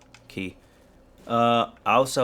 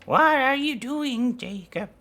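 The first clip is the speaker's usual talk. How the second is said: put-on high-pitched questioning voice; imitation voice; 11 semitones higher than usual. Streamer playfully questions Jacob in a high imitation voice. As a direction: go high and stay there, easing down on the name. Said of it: "whole imitation stream again".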